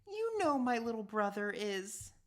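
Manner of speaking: high, fond protective tone; protective mom. Acted. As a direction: defend her little brother. Mom defends John.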